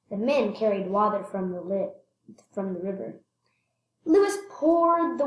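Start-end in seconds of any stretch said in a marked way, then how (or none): none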